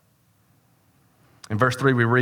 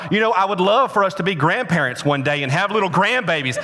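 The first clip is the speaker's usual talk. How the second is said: higher-pitched mock tirade from the wife; hammed-up nagging rant; scolding wife; 7 semitones higher than usual. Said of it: "she sat up like a demon".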